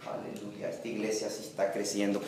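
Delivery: low, soft aside. Real, not acted. Preacher quietly noting the church is growing. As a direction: say it quietly to yourself.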